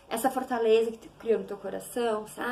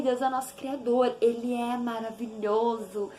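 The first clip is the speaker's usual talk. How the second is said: ordinary talk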